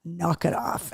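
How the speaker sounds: low, quiet imitation of a fed-up dog; lightly acted, hushed telling-off; fed-up older dog. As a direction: voice the dog's soft, low warning. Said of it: "she turns on her and she's like".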